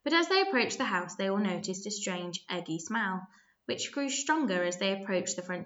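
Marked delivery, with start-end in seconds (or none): none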